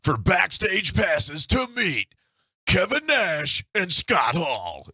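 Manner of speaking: radio promo host. Retold or imitated. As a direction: draw it out like a cheesy radio promo. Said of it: "it was like".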